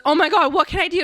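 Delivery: high, loud, flustered concern; acted alarm. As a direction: play a nurse alarmed at someone's sobbing. Acting out nurse alarmed by sobbing.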